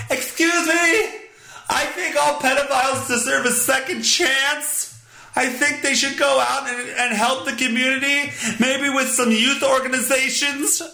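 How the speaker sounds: high-pitched caricature of an objector; mock objector. Host voicing an imagined dissenter in the audience, high mock voice. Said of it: "Anybody going".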